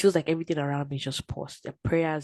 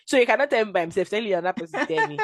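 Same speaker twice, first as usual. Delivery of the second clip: fast, raised, laughing protest; laughing complaint; 4 semitones higher, 5 dB louder, faster than usual. Retold or imitated. Complaining God sends messages through others, laughing. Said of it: "I'm like".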